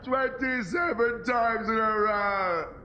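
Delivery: slow, drawn-out twenty-seven times in a row; slow amazed stress. Acted. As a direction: stretch out "Twenty-seven" in disbelief.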